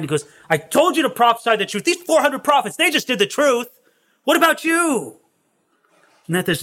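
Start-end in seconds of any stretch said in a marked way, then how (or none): 0.5-5.2 high-pitched, indignant mock outburst; mad. King scolding the prophet over the truth, acted mad. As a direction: act out an exasperated king demanding an answer. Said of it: "Ahab got so mad".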